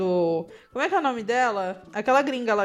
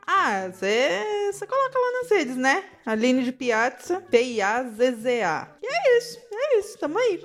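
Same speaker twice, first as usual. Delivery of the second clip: Aline imitation. Co-host mimicking her partner's social media plug, teasing imitation. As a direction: parody your co-host's way of plugging her socials. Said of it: "Gostou da minha imitação, amiga?".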